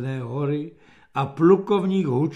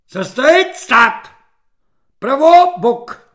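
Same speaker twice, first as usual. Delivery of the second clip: loud, high parade-ground orders; shouted captain's orders; military command; 11 semitones higher, 12 dB louder, slower than usual. A narrator's reading. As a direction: bellow drill commands at the troops. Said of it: "velel kapitán".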